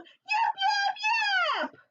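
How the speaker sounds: very high little fox yips; high squeaky fox call. Acted. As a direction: yap it out like a small fox calling. Fox calls out in story.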